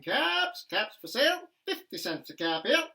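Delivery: loud, high peddler's call; calling out wares. Acted. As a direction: sing it out to the street like a hawker.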